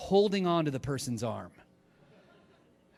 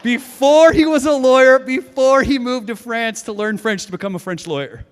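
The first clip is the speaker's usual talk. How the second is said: loud, high punchline; excited reveal; 7 semitones higher, 11 dB louder than usual. Real, not acted. Preacher revealing Abraham's past to the congregation, loud. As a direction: proclaim the twist with amazement.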